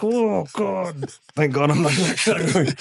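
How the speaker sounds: mock-gasp of relief at dodging the Russians; relieved quote. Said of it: "I was like".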